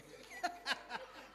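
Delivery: short unvoiced burst of laughter. Real, not acted. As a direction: laugh at your own joke. Laughs at own joke with children. (हसणे)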